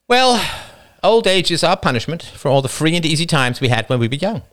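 the wife, wry and knowing. Acted. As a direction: deliver the saying with a dry sigh. Wife philosophising wryly.

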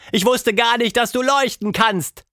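hohe, staunende Kinderstimme; Tilda, staunendes Mädchen. A narrator's reading. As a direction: wie ein Kind, das sich wundert. Tildas Stimme. (Tilda staunt über ihren leuchtenden Freund)

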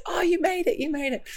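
high, light party welcome; mock cheery greeting. Party guests welcoming her in, mock cheery greeting.

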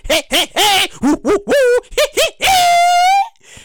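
rising hey hoo hee chant, high at the top; party hype chant. Retold or imitated.

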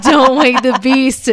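playfully loud and drawn-out, as the tiptoeing cat; cat's mock warning. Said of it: "He was like".

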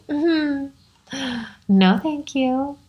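high, drawn-out no thank you; a sing-song polite refusal; mock polite refusal. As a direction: turn it down sweetly but firmly. Refusing when told to surrender.

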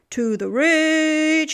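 high, slow, loud grand proclamation; proud walrus proclaiming. A narrator's reading. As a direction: announce it like a pompous walrus. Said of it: "he proclaimed, with his chin in the air".

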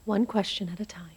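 soft unvoiced whisper. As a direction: hush her gently. Medium quietly guiding séance.

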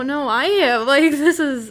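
moved and insistent; emotional sympathy. Real, not acted. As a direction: jump in moved, voice lifting. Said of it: "I'm getting emotional".

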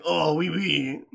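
lightly put-on French accent; mock French accent. Shown as a demonstration. Showing off their own French, light mock French accent. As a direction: toss it off in pretend French. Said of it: "my French is like".

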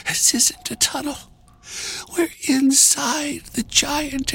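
high, breathless, feeble woman; weak gasp. A narrator's reading. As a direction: gasp it out high, slowing as strength fails. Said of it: "the weak woman gasped".

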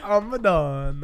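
loud, slow mock-Italian; hammed-up Italian echo; Italian accent. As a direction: draw it out with a put-on Italian accent. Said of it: "why you sound Italian in my impression".

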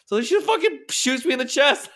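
can't keep it together; laughing. Real, not acted. Laughing as he tells his friend he got shot, high and loud.

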